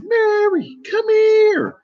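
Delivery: put-on shrill calling voice of an older lady; high-pitched older lady calling. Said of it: "Just like that".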